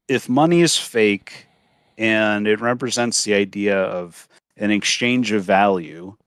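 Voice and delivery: ordinary talk, nothing marked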